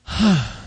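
open, breathy 'hah' sigh; tired sigh. Shown as a demonstration. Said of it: "like you're tired".

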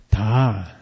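long, low 'Ta' in the throat. Shown as a demonstration. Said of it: "So listen to them again".

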